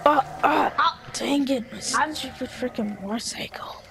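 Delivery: normal talking voice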